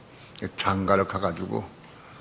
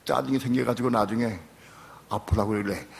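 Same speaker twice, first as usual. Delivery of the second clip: normal talking voice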